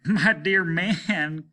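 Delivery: captain scoffing at the mayor's word, loud and slow; cried out. Acted. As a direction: cry out in mocking disbelief. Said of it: "cried the captain".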